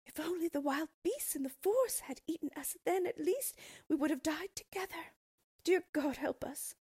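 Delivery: high, loud desperate pleading; crying plea. A narrator's reading. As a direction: beg God in terror, high and loud. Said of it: "they cried".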